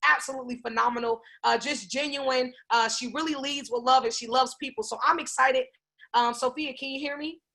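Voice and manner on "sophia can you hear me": ordinary talk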